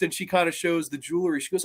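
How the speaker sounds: ordinary talk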